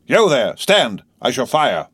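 loud, high shouted threat; roared challenge. A narrator's reading. Guard halting a galloping horseman, roared challenge. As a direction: shout a halt to a stranger. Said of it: "as loud as he could roar".

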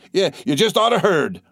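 high-pitched, excited repeat; itching to tell the tidings; eager cry. A narrator's reading. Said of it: "cried one eagerly".

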